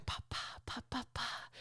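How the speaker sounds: soft breathy whisper of pa syllables; whispered baby babble. Retold or imitated. Husband copies the baby while carrying laundry: whispered babble. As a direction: murmur it like the baby does. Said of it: "he's just going".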